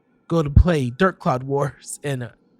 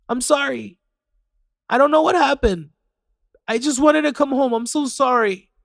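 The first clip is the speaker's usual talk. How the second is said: put-on higher, tearful child's apology; 8 semitones higher than usual. Child apologizing to mom through tears. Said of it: "she was crying too".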